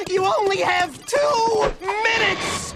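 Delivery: slow, emphatic warning call; urgent shout. Acted. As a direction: hammer home the deadline. Urgent warning about bombs.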